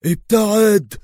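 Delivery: loud, high urgent shout; alarmed cry; screamed warning. A narrator's reading. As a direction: yell a warning to get clear. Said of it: "وصرخ برنارد".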